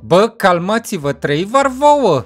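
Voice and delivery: ordinary talk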